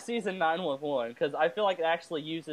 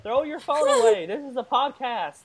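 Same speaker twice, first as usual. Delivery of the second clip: louder and higher, telling her off; real playful yell; 7 semitones higher, 4 dB louder than usual. Real, not acted. Co-host telling the texting host to drop the phone, yelling.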